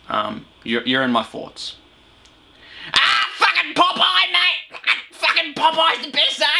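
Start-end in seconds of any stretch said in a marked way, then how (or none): 2.9-6.5 high-pitched mock excited mate; really excited. Host impersonating school mate on Popeye cartoons, excitedly. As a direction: imitate a hyped friend, voice shooting way up. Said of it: "he'd get really excited".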